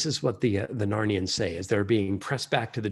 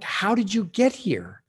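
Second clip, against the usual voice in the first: astonished question; 8 semitones higher than usual. Acted. Children astonished at kings.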